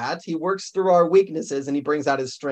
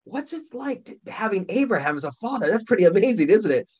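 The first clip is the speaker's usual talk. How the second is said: high, chatty curiosity with a laugh; eager fan; 6 semitones higher than usual. Pretend. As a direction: pepper him with eager questions. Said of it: "you go".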